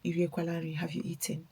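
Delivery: cheating boyfriend acts sweet on the phone, hushed imitation; imitating his sweet tone. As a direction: put on his innocent caring voice. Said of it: "he will go with this tone".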